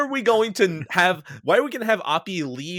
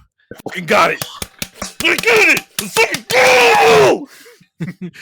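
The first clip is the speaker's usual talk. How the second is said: builds to a much louder, higher shout; triumphant shout; 10 dB louder than usual. Pretend. The composer answering Nolan, in a triumphant shout. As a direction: yell it like you've finally cracked it.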